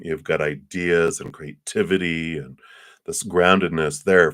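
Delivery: normal talking voice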